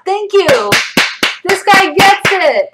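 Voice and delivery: loud, high, emphatic agreement; excited approval. Real, not acted. Streamer praising a commenter who understands, excited approval. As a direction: exclaim it with triumphant vindication.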